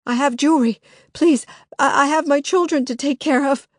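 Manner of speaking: Lily bargaining with the intruder, frantic; pleading. Acted. Desperate frantic plea.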